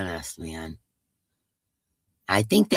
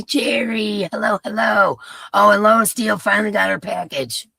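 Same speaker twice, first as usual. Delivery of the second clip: high, loud happy hello; excited greeting; 8 semitones higher, 6 dB louder than usual. Real, not acted. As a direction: call out a cheerful hello. Greeting a chat member.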